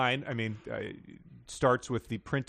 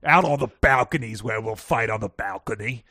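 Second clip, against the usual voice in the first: much louder hammed-up British dare; mock British challenge; 8 dB louder than usual. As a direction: call him out to the balcony, posh and loud. Role-play challenge.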